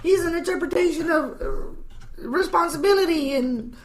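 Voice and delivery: high put-on child voice drifting into an accent; kid with accent. Little Jimmy explaining why he burned the house, high mock-kid voice. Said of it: "I don't know why he picked up an accent towards the end".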